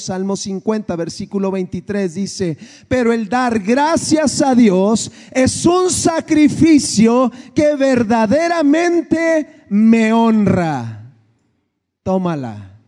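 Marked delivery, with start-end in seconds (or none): 2.9-11.1 slow, louder scripture reading; a preacher reading the psalm out loud, slow and strong; preaching scripture. Acted. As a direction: proclaim the verse to the congregation. Preacher reads a psalm aloud.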